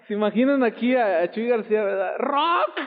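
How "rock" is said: high-pitched mock shout; grito en broma. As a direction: blurt it out as a joking church cry. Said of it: "no sé por qué grité". (grito agudo en broma)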